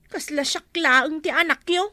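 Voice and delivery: normal talking voice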